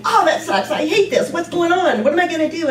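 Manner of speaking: mock despair. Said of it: "it's gonna be".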